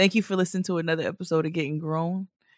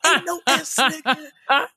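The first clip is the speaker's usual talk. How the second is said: irritated, laughing callout; 13 semitones higher, 6 dB louder, slower than usual. Retold or imitated. Mocking wrong pronunciation, irritated.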